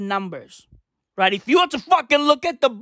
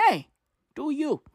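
usual speaking voice

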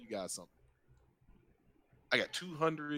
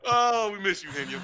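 higher, louder fond shout; loud call-out; 7 semitones higher, 7 dB louder than usual. Real, not acted. Cohost shouting a fond hello to the chatter. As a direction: call out to an absent friend.

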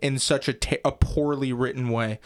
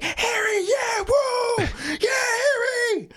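very high squealing cheer; mock crowd cheer; much slower than usual. Imitating fickle schoolmates as the games start, high mock cheering. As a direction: whoop like an adoring crowd, drawn out at the end.

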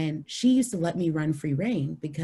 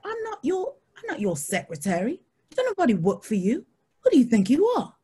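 indignant mock Scottish accent; Scottish telling-off, building to a loud finish; Caroline; 7 semitones higher, 4 dB louder than usual. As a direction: scold someone in an imitated Scottish voice. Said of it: "Caroline was from Scotland".

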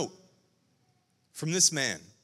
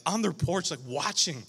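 usual speaking voice

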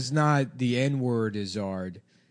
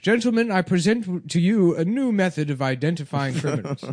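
pompous Victorian gentleman; 3 semitones higher, slower than usual. Acted. Inventor presenting in 1800s.

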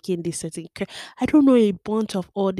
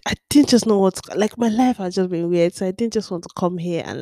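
ordinary talk, nothing marked

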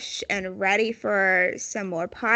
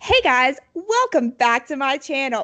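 fake YouTuber greeting; 4 semitones higher, 4 dB louder than usual. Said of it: "pretend to be all like".

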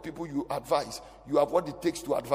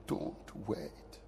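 slow soft murmur; hushed aside; 7 semitones lower, 10 dB quieter, much slower than usual. Real, not acted. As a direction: drop to a slow, breathy hush. Quiet aside to congregation.

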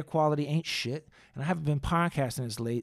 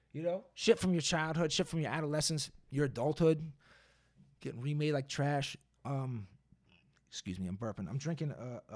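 ordinary talk, nothing marked

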